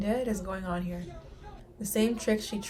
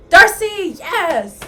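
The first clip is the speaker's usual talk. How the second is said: high, very loud cheer; excited shout; 8 semitones higher, 15 dB louder, much slower than usual. Real, not acted. Fan cheering Darcy's arrival, shouting excitedly. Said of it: "Sorry, I'm excited, guys".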